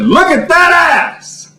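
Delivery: high, drawn-out holler; imitated jeering shout; mock catcall. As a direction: call across the street, stretching every word. Man in town calls out.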